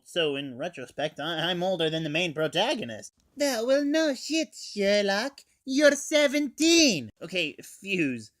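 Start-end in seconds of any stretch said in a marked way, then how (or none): 3.4-7.1 high, loud sarcastic outburst; sarcastic exasperation. Real, not acted. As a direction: scoff at him for stating the obvious. Mocking friend's obvious remark.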